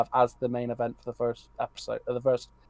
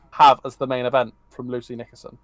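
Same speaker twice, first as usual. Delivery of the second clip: normal talking voice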